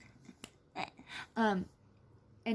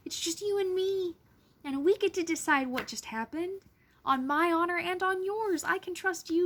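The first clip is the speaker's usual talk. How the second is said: passionate; 6 semitones higher than usual. Real, not acted. Passionate speech about fighting.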